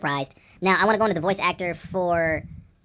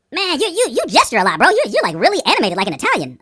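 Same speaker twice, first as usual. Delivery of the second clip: higher, louder, animated mimicry; imitated remark; 8 semitones higher, 6 dB louder than usual. Host mimics people commenting on his gestures, animated. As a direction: play an excited person making a blunt remark. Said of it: "people say to me".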